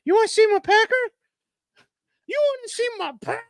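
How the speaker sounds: squeaky high cartoon bird, building to a shout; high-pitched silly bird shouting. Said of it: "the bird flying up and going".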